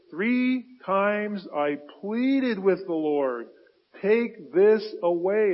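slow, loud pleading scripture read; preaching at full force. Acted. Preacher voicing Paul begging God, slow and loud. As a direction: plead the line slowly and loudly. Preacher quoting Paul's plea.

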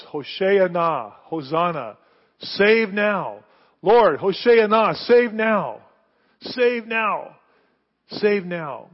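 loud, slow, pleading hosanna chant; loud crowd cry. Retold or imitated. Crowd on the Mount of Olives crying out, loud pleading chant. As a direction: call it out like the crowd.

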